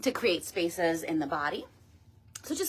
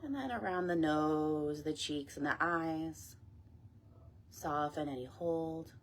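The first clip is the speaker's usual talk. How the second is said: guided meditation; 5 dB quieter than usual. Acted. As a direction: ease them gently down, phrase by phrase. Leads a body scan meditation.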